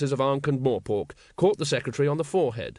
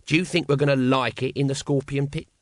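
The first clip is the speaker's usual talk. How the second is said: ordinary delivery, nothing marked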